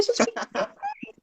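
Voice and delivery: high, hushed amazed voice; quick, soft, high-pitched disbelief; astonished. Retold or imitated. Coach reacting to her transformed client, astonished.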